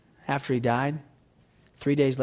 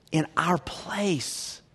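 hushed whisper; 2 semitones higher, 4 dB quieter, much slower than usual. Acted. Hushed end of a slow phrase.

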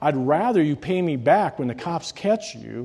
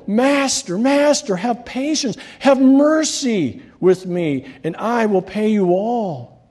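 much higher, begging servant's cry; desperate pleading; 8 semitones higher, 4 dB louder, slower than usual. Acted. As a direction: plead for your life, face down. Servant begging his master.

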